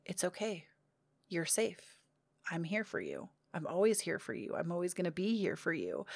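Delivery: gentle self-comfort. Retold or imitated. To herself while hugging and rocking, a soft reassuring hush. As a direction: murmur it like soothing a crying child.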